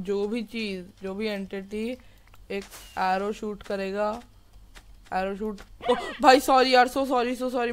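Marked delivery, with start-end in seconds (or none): 5.9-7.7 louder, higher, rushed apology after a scare; startled panicky apology. Real, not acted. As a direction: blurt out sorry like you just got spooked. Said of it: "मैं डर गया यार". (डरकर घबराई हुई माफ़ी)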